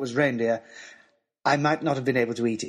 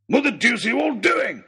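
high, loud, indignant outburst; grumpy old professor; 7 semitones higher, 4 dB louder, faster than usual. Acted. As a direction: bellow like a rudely woken professor. Professor woken in the night.

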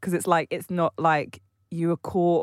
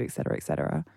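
dismissive; 7 dB quieter, much faster than usual. Real, not acted. Trailing dismissive list.

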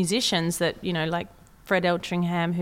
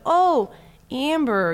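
higher, louder American "Oh, Amber"; mimicked American reply; 7 semitones higher, 5 dB louder, much slower than usual. Barista finally understands the name, mimicked American accent. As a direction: imitate an American catching on to "Amber". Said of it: "They go".

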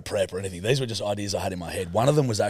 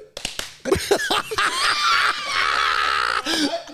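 long, high, howling laugh; big burst of laughter. Real, not acted. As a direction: throw your head back and howl with laughter. Cracks up at own story.

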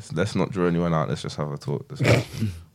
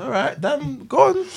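surprised. Real, not acted. Teasing a friend about babies.

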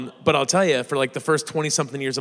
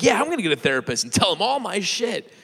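excited; 8 semitones higher than usual. Retold or imitated. Retold eager decision.

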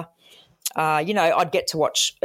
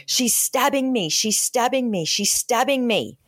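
higher-pitched, retold cry of terror; mock panicked scream; 7 semitones higher than usual. Caller on the phone crying that his wife stabs him, pitched-up terrified yell. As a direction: imitate a man yelling in pain that he's being stabbed. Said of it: "starts screaming and screaming".

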